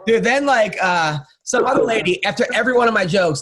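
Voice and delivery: normal talking voice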